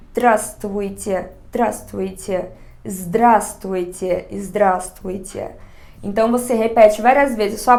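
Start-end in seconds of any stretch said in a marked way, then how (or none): 0.1-5.5 "здравствуйте" drawn out very slowly, syllable by syllable; sounding out words. Shown as a demonstration. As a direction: repeat "здравствуйте" very slowly, carefully. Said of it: "começa a falar ela sílaba por sílaba". (pronunciando bem devagar, sílaba por sílaba)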